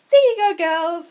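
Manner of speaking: high, loud call to pets; guinea pig call voice. Shown as a demonstration. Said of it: "in that voice".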